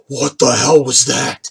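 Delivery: slow, dazed question; lightly voiced, stunned Norik. Norik reacts to being dropped at their feet, stunned. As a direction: say it slowly, as if just dropped.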